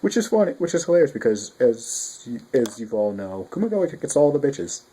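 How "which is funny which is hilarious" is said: amused. Real, not acted. Amused commentary.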